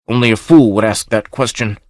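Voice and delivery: plain reading voice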